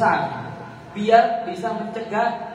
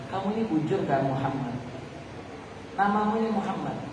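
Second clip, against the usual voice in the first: hushed whisper; 2 semitones lower, 8 dB quieter, faster than usual. A narrator's reading. The priest softly asks the baby if he is Muhammad, hushed.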